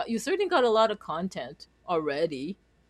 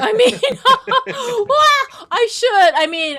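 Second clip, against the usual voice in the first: much higher and louder, laughing through the words; 14 semitones higher, 14 dB louder, slower than usual. Real, not acted. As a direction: giggle out an embarrassed admission. Laughing at her own answer.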